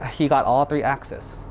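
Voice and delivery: plain reading voice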